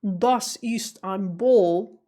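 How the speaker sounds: put-on slow German with an American accent; imitating an American mangling German; bad American-accented German. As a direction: say the German slowly with an American drawl on "Ball". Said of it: "I've got an example for you. When he said".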